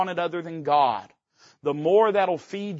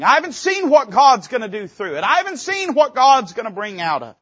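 ordinary talk

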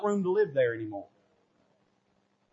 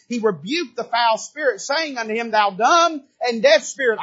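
scripture read with fervour; 8 semitones higher, 9 dB louder than usual. Acted. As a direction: thunder out the rebuke. Reads scripture with force.

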